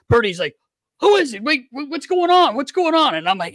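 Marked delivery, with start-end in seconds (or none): 1.0-3.1 imitating a frantic, high-pitched buddy; high, urgent mock demand to be let in on it; hyper questioning. Equipment manager pesters caller about phone news, hyper. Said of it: "like, he's so hyper".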